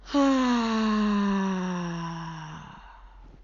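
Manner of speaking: long loud sigh out through the mouth; deep sigh. Shown as a demonstration. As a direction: let a full audible sigh go. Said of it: "I'm gonna do three sighing breaths".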